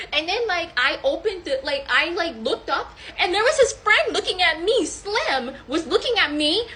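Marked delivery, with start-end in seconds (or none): none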